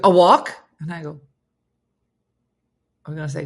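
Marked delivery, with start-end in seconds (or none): 0.0-0.5 imitating dog. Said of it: "she'd go".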